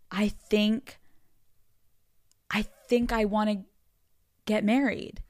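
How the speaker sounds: halting, with long pauses between phrases; hesitant confession. Retold or imitated. Telling her therapist she wants marriage, hesitant. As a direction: let it out bit by bit, tentatively.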